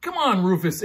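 loud, high call to a friend; Ava yelling. A narrator's reading. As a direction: call him in out of the storm. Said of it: "Ava yelled".

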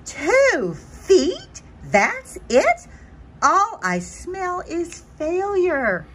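high, loud shock sinking into slow gloom; dismayed disbelief. Acted. Butterfly learning she flew two feet, high shocked dismay. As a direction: squeak it out, then sag.

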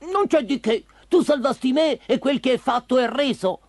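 high put-on dog voice; kindly dog. Dog telling Pinocchio the favour is returned, high and kindly.

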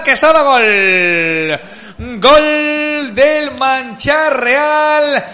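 very slow, stretched goal; drawn-out goal call. Real, not acted. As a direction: hold the goal word. Opponent scores.

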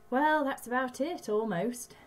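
lightly voiced old stranger; a hint of a mysterious stranger; stranger's voice. Reads stranger character.

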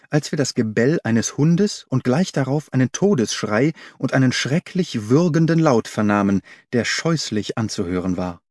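ordinary delivery, nothing marked

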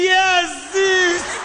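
high, loud yes; emphatic agreement. Retold or imitated. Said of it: "Then I'll say".

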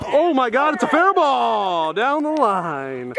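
high, drawn-out fair-ball call; excited call. Real, not acted. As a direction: stretch the call out in amazement. Calling a surprise fair ball.

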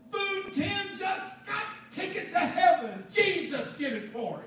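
high, loud joyous announcement in broken English; excited joyful shout. Retold or imitated. New convert announcing to the teams, joyful loud shout. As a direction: call it out to the whole team, overjoyed.

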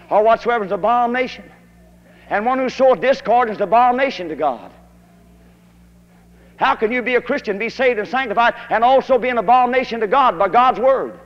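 loud, high, hammering revival preacher; preaching at full force. Acted. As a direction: thunder the warning at the congregation. Preacher's climax on lying.

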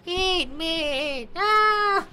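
high mock yell of a game character; voicing a character. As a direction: squeal it as the character. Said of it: "He's like".

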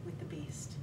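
hushed breathless whisper; dramatic whispered reveal. Retold or imitated. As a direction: whisper it like facing a monster. Locks eyes with raccoon.

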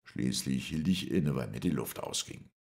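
ordinary delivery, nothing marked

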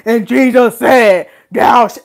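slow, loud preacher bark; barking Bible narrator. Retold or imitated. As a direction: boom the scripture out like a stern radio preacher. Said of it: "they be barking at you".

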